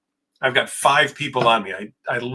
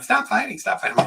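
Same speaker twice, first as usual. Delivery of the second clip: sister's plea, pitched much higher; pleading sister; 10 semitones higher than usual. Retold or imitated. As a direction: mimic the little sister begging them to stop. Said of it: "sister's like pulling away, going".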